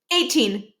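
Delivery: ordinary delivery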